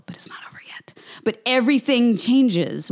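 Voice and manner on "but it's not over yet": dramatic trailer whisper; teaser whisper. Acted. Reading the book blurb aloud, dropping to a whisper. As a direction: breathe it like a suspense teaser.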